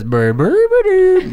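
burbled creature noises rising to a loud, high squeal; Grimace babble. Retold or imitated. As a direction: babble like a monster, then shoot way up. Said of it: "And he says".

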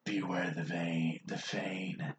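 put-on low, quiet slurring drunk. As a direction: mumble it like a drunk stumbling off. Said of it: "in a very drunk voice".

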